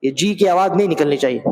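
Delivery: ordinary talk, nothing marked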